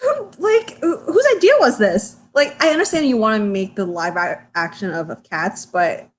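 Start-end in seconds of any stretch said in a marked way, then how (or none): 0.0-2.2 mock outrage. Real, not acted. Outraged joining in.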